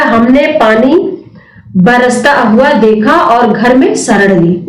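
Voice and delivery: slowed down, repeating the correct sentence; slow reading. Shown as a demonstration. Teacher slowly repeating the correct answer for students. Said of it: "संयुक्त वाक्य है".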